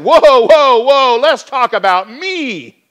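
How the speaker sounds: high, loud, slowed "whoa, whoa"; alarmed protest. Pretend. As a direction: throw up your hands and cry out about yourself. Preacher imagines his own self-defence.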